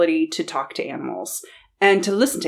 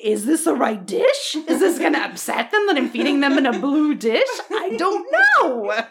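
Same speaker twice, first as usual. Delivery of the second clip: mock worried owner; 4 semitones higher than usual. Fretting over the cats' blue dish, half-laughing. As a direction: wonder aloud, half-laughing at yourself.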